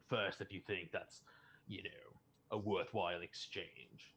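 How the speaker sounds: level with him slowly, weighing each phrase; measured, probing prisoner. Acted. Anon voices a suspicion to Orc in prison, measured and probing.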